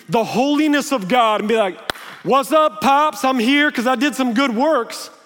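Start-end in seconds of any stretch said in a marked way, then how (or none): 2.2-5.1 a loud, breezy, overconfident greeting; cocky swagger. Pretend. Sinner casually greeting God as Pops, cocky and loud. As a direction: stroll in like you own the place.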